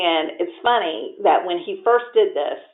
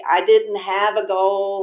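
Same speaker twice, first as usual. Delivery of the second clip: ordinary talk, nothing marked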